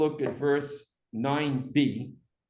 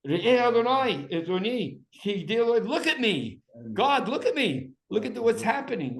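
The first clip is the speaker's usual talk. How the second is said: screamed plea; 7 semitones higher than usual. Acted. Teacher voices the woman crying to God, high mock scream. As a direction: cry out to God in pitched-up desperation. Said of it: "she screams out".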